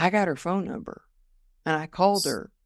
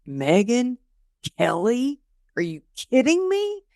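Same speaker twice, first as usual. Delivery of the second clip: stunned; 6 semitones higher, 3 dB louder than usual. Retold or imitated. To her publicist, stunned and much higher. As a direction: pitch climbing far up into disbelief. Hearing Today Show news.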